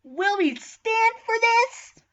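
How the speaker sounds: high, drawn-out rallying call; rallying shout. Acted. Student rousing fellow comrades, high rallying shout. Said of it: "I shouted at my fellow student".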